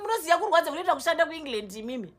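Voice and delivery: loud, furious, hurt; angry shouting. Acted. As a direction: shout your hurt at her. Daughter rages at mother, pastor car.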